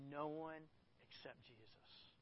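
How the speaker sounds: No one except Jesus, dropped to a slow near hush; hushed emphasis. Shown as a demonstration. Preacher asking the church to underline the words, hushed and slow. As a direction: let the words sink in softly and slowly. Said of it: "underline the words".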